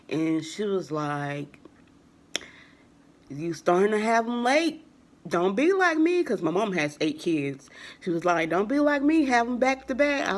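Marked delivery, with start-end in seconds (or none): 3.3-6.2 high, loud imitation of mom's warning; scolding mother. 8.5-10.3 high, loud imitation of mom's warning; scolding mother.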